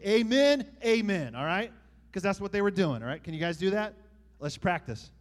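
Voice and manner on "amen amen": called-out "Amen, amen", much louder and higher. Shown as a demonstration. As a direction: cue the congregation to answer. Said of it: "I want you to say".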